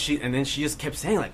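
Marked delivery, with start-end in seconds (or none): none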